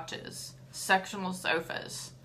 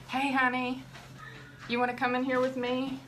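sweet call; 6 semitones higher than usual. Real, not acted. Calling son, sweet voice.

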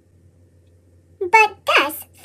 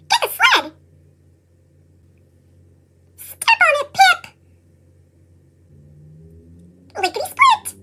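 much higher mouse voice, hurrying; squeaky mouse urging; 11 semitones higher than usual. Acted. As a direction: squeak at your partner to hurry.

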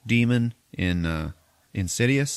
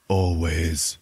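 a hint of a low man's voice from a girl; mildly deeper possessed girl; grown man's voice; 3 semitones lower than usual. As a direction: lightly drop into a man's voice for one word. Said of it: "in a fucking grown man's voice".

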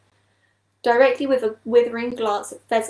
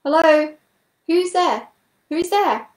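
high, loud searching call; 7 semitones higher, 6 dB louder, slower than usual. A narrator's reading. Unseen newcomer hails from the passage, calling out. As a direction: call down a long passage to whoever is there. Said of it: "a voice could be heard from the long passage outside".

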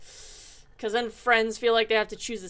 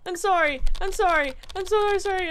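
higher, louder, rushed sorries; frantic apology; 6 semitones higher, 4 dB louder than usual. Real, not acted. To crying Emily, a frantic higher louder apology. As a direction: scramble to apologise, flustered.

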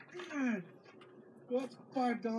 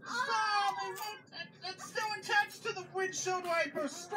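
desperate shout; 8 semitones higher than usual. Real, not acted. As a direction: yell in real despair. Pizza stuck on the wiper.

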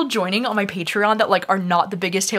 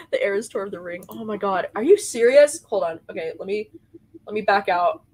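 normal talking voice

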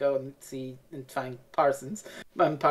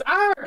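high strangled yelp; pained cry; 4 dB louder than usual. Retold or imitated. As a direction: yelp as if attacked. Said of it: "he goes".

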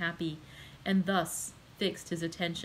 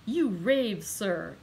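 exclaimed; 4 dB louder, much slower than usual. A narrator's reading. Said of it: "exclaimed Villefort".

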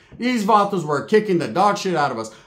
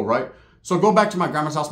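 ordinary talk, nothing marked

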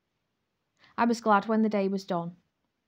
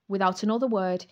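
plain reading voice

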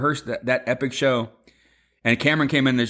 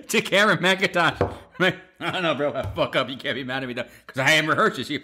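laughing; 3 semitones higher than usual. Real, not acted. Laughing while retelling the story.

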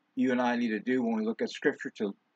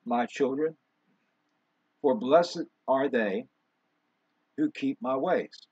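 scripture reading. Real, not acted. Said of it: "where it reads".

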